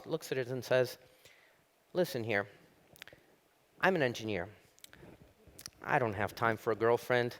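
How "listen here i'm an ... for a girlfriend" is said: lightly voiced matter-of-fact engineer, long pauses; measured, understated brush-off; deadpan engineer. Engineer turns down the talking frog, understated and deliberate. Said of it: "The guy takes out the girl, looks at it, and says".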